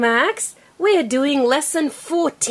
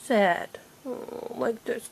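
sad face voice; 9 dB quieter, slower than usual. Shown as a demonstration. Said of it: "Make a sad face".

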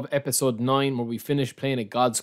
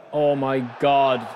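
usual speaking voice

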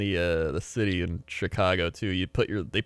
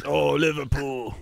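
higher, slow mock British accent; British guy; 5 semitones higher, slower than usual. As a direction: stretch it like a British football fan. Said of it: "your British guy".

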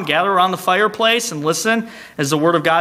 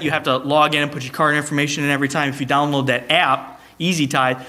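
ordinary delivery

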